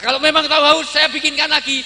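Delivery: high, very loud hammed-up wife; shouted reply. Wife offers to make another drink, acted shouting. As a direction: burst out at full volume.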